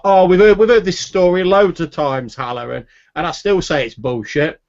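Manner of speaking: loud, high fed-up sailor; exasperated heckling. Acted. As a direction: groan out a loud protest at the storyteller. Sailor heckles Haller's oft-told tale.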